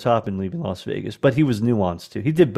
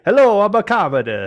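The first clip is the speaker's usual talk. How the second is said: high, loud mock-Italian accent; bad Italian accent; 9 semitones higher, 5 dB louder than usual. Actor failing at Commodus for laughs, hammy Italian accent. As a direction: ham up a fake Italian accent. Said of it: "trying to act like an Italian accent".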